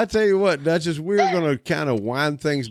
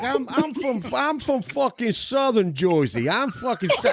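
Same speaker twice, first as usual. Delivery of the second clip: mock Jersey guy; 8 semitones higher than usual. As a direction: ham up an offended Jersey local. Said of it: "He's like".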